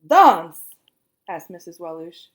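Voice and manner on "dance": loud startled question; surprised. A narrator's reading. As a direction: fire the word back in surprise. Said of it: "She looked very surprised".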